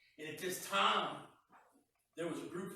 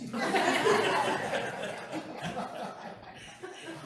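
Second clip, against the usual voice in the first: laughter; 14 semitones higher, 9 dB louder than usual. Real, not acted. Preacher laughs at own punchline.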